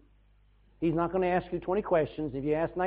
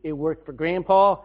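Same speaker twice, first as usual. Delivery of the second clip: usual speaking voice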